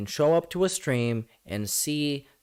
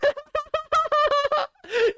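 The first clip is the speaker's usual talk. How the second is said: mocking high laugh; high laughing burst. Real, not acted. Laughs at getting emotional.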